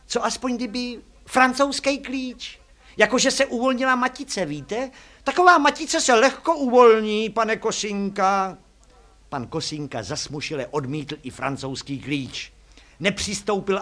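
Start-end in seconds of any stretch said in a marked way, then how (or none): 0.1-8.6 put-on high, loud pleading girl; girl character. A narrator's reading. Girl asks the driver for a wrench instead, high character voice. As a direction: propose it high, drawing out the endings.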